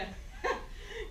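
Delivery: high breathy witch cackle; evil cackle. Acted. Giant's wife cackles at Jack in the oven.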